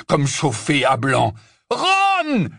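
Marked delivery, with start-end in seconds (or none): 1.7-2.5 high piercing cry of a friend's name; sharp high yell. Acted. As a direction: scream his name in alarm. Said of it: "hurla-t-il".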